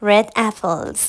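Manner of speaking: slow, loud teaching demo; slow model sentence. Shown as a demonstration. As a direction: lay it out slowly for learners.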